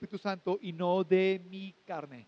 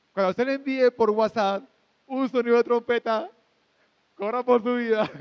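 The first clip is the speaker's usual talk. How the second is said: loud joking warning; 2 semitones higher, 6 dB louder than usual. Acted. Joking warning about trumpet sound.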